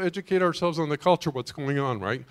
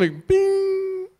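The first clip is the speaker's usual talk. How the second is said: high squeaky bing like a phone alert; ping it like a notification; phone ding imitation. Pastor imitates his phone alert, high ding.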